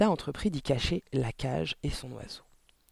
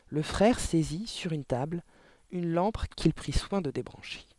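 usual speaking voice

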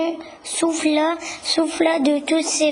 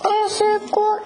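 higher squeal of alarm, piglets calling; high cry for help; 8 semitones higher than usual. A narrator's reading. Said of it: "cria le, les deux petits cochons".

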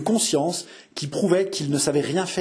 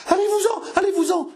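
half-dead with fear, beg them away; terrified wail. A narrator's reading. Innkeeper dangling from the window wails at the servants, very high. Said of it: "gémit l'aubergiste plus mort que vif".

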